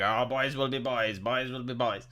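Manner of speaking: very low, quiet monotone chant; mock droning fathers. Fathers at the barbecue in a commercial, low mock drone. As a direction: imitate dads intoning it in unison.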